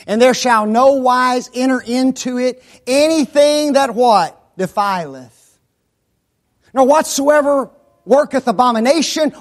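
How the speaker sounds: loud, fast revival preacher; fiery preaching. Acted. Preaching at full force.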